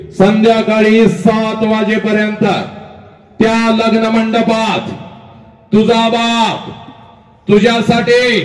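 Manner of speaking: high, slow declamation in separated phrases; dramatic preaching. Acted. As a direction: proclaim each phrase on a held high note, then pause. Oratorical build to a climax.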